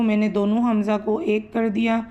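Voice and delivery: ordinary delivery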